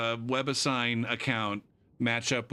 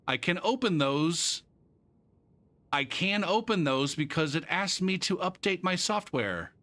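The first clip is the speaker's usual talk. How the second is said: higher mock-reading voice; reading student email; 6 semitones higher than usual. Teacher reading out a student's email, higher. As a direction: read his misspoken line as written. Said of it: "I'm guessing he meant to say".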